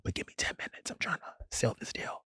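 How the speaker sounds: breathy whisper, secretive; whispered aside. Retold or imitated. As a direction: whisper it so the man can't hear. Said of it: "I'm like".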